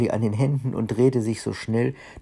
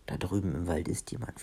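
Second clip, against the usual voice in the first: lowered, soft storyteller's near-whisper; hushed warning; 3 semitones lower, 7 dB quieter than usual. A narrator's reading. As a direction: drop your voice as if someone might hear. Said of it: "flüsterte sie". (gedämpft, fast geflüstert)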